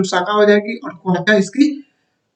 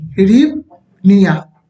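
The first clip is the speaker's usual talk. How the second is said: "हिरिवनिया" said slowly, broken into parts; slow demonstration; much slower than usual. Shown as a demonstration. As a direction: spell out "हिरिवनिया" by ear, pausing before the ending. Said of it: "इसकी currency हिरिवनिया".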